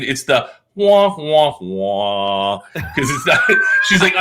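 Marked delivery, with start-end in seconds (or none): 0.7-2.6 drawn-out, descending wah-wah noise; sad trombone. Retold or imitated. Wife's joke about being overlooked, sad-trombone imitation. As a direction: slide down like a deflated horn.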